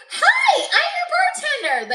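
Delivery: very high, loud, bubbly bartender greeting. Retold or imitated. Imitating the bartender greeting customers, high perky squeal.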